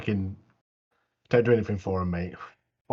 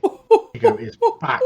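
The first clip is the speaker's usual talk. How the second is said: very high, slow, laughing cheer; laughing hype. Real, not acted. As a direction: squeal it out with delight. Laughing hype over Bakugo's return.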